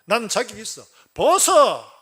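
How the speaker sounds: loud self-righteous retort, rising to a shout; brazen confrontation. Retold or imitated. Self-righteous son talks back to his father, brazen shouting. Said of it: "아버지에게 대놓고 이렇게 말합니다".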